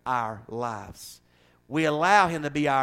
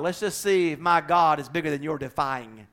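normal talking voice

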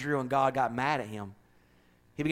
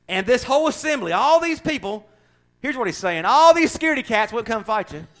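mocking trash talk; 8 semitones higher, 6 dB louder than usual. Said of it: "He's still trash talking".

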